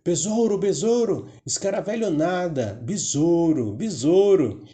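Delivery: high-pitched mocking cry; taunting shout. A narrator's reading. Praying mantis taunting the furious beetle, high-pitched shouting. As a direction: call it out high, jeering at the beetle. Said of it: "que começou a gritar".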